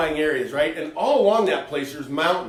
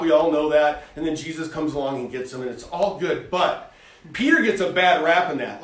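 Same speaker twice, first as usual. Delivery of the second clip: usual speaking voice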